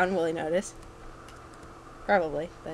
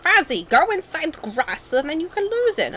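high, slowed mock voice of nagging viewers; mock yelling viewers; 9 semitones higher than usual. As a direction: imitate backseat players yelling, high-pitched. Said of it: "people are yelling at me like".

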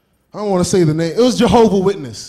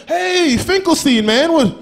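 friendly high invite; 8 semitones higher than usual. Retold or imitated.

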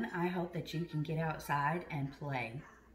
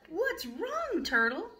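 much higher, concerned asking; caring question; 11 semitones higher, slower than usual. Acted. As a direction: ask high and caring, leaning in. Pete asks Turtle kindly.

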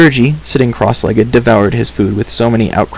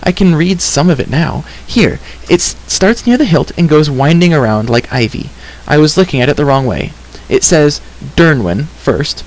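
higher-voiced girl, working out an inscription; eager girl character; 4 semitones higher than usual. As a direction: puzzle it out aloud as a keen young heroine.

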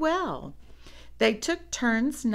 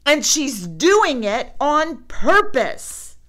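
loud, slow, higher complaint; complaining; 7 semitones higher, 9 dB louder than usual. A narrator's reading. Brother complaining to Papa about sister, loud protest. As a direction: protest it loudly. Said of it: "complained Brother".

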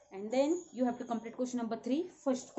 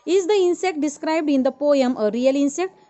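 loud dictation; 14 dB louder than usual. Real, not acted. Teacher loudly dictating the question for notebooks. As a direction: question called out loud, rising at the end.

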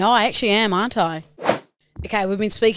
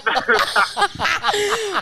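high burst of laughter; 13 semitones higher than usual. Real, not acted. Friend laughing as the prank is revealed, high burst. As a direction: crack up at the reveal.

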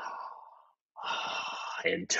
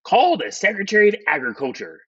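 higher, quick raised-voice command; shouted order; 7 semitones higher, faster than usual. A narrator's reading. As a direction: call out the order briskly, pitched up. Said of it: "shouted President Taft".

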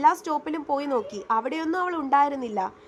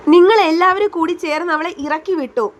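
louder, hurling the blame at the family; furious accusation; 3 semitones higher, 9 dB louder than usual. A narrator's reading. Siddharth blames his family for driving her away, furious and louder. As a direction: shout it at them in rage. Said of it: "അടക്കാനാവാത്ത ദേഷ്യത്തോടെ".

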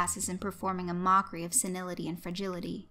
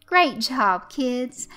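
cheerful praise; 5 semitones higher, 9 dB louder, much slower than usual. Acted. Host praises children.

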